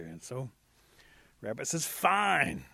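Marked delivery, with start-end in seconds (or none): 1.9-2.6 high, loud exasperated giving-in. Acted. Rabbit agrees to a party for Possum, exasperated. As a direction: concede it loudly, fed up.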